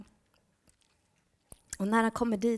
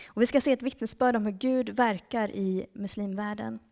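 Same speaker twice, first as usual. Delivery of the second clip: usual speaking voice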